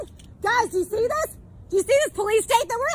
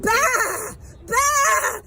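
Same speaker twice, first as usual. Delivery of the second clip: imitating a sheep in a sneering bleat. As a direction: bleat like a sheep to mock them. Mocking people as sheep.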